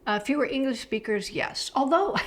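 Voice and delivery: ordinary talk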